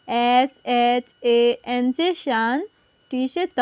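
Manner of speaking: patient teacher spelling out; slow spelling. Shown as a demonstration. Teacher slowly spells a word for pupils. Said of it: "शांत को English में कैसे लिखेंगे".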